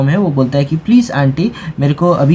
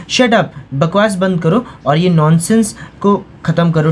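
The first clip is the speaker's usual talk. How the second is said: ordinary talk, nothing marked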